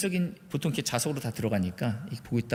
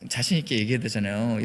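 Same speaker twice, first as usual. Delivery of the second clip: ordinary talk, nothing marked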